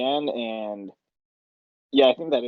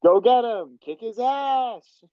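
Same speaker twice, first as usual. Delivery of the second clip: high, slow hyped-up cheer; egging on; 11 semitones higher, much slower than usual. Retold or imitated. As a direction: cheer the fighter on from the sidelines. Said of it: "egging them on in the background".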